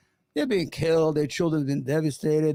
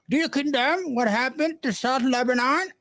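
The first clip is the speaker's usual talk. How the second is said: put-on higher, more pronounced English accent on the second try, Lebanon rising; mock English accent; 8 semitones higher than usual. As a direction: redo it with a stronger English accent. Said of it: "English accent?".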